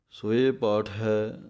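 usual speaking voice

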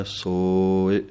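ਸੋਇ with the o held long, very slow; long o vowel. Shown as a demonstration. Teacher showing how to lengthen ਸੋਇ. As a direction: stretch the o in ਸੋਇ, barely touch the i. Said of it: "ਸਸੇ ਹੋੜਾ ਲੰਮਾ ਬੋਲਣਾ ਹੈ".

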